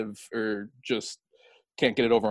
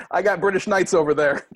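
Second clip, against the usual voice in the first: loud, higher mock trash talk; pickup game trash talk; 6 semitones higher, 6 dB louder than usual. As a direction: call it across the court. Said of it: "calling you British Knights in a pickup game".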